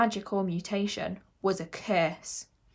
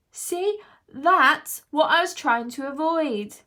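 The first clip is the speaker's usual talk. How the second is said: high, loud, slowed-down I-told-you-so; exasperated llama; 7 semitones higher, 7 dB louder, slower than usual. A narrator's reading. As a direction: make the point loudly and deliberately. Levi remarks after his outburst.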